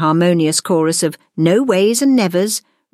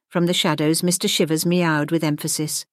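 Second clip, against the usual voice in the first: ordinary delivery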